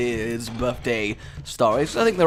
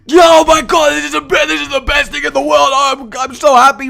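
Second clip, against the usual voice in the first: much higher and louder, over-the-top joy; ecstatic kid; 12 semitones higher, 11 dB louder than usual. Retold or imitated. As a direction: shriek it like a seven-year-old opening a huge box. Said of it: "my reaction was along the lines of".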